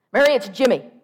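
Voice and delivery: loud phone yell of the sister; full-volume announcement. Acted. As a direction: shout the news down the line. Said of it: "she was yelling".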